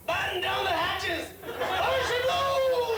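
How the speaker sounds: slow, high, hammed-up sailor's shout; mock sea captain cry. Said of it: "I get to hear".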